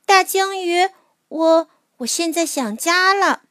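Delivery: high, small longing voice; a hint of a sad child; homesick little mole. A narrator's reading.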